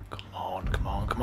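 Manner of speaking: unvoiced, soft come on; whispering. Real, not acted. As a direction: whisper it under your breath. Tense moment in game.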